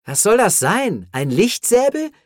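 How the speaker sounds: high, loud scoffing questions; derisive, incredulous jeer; mocking. A narrator's reading. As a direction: scoff with a steeply rising pitch. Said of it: "spottete Jess".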